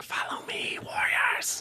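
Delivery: whispered mental voice, very soft; hushed otherworldly whisper to the troops; Sparklet. Acted. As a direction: whisper it as a telepathic summons. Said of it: "mental voice. He's like".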